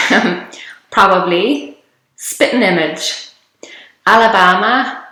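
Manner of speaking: ordinary talk, nothing marked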